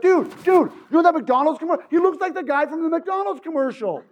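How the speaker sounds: can't wait to share the joke; bully eagerly telling friends about the commercial, gleeful; excited bully. Retold or imitated. Bully excitedly making the link.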